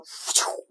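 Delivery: short voiceless whoosh of gusty wind; rushing wind noise; imitated wind gust. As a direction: blow a gust of wind with the mouth. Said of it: "that kind of wind that was like".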